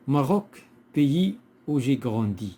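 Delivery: measured classroom poem reading; poem reading aloud. Shown as a demonstration. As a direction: read the verse out loudly and deliberately. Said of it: "on va lire le poème".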